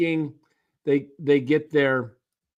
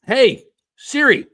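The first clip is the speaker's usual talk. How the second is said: calling out; 5 semitones higher, 8 dB louder than usual. Real, not acted. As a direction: call out to the phone. Calling the phone assistant.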